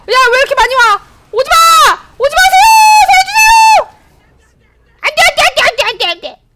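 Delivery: high, loud hammed-up panicked cries; mock panic. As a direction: shriek as the enemies swarm in. 적이 몰려와 비명. (적이 몰려오자 장난스럽게 높고 크게 비명)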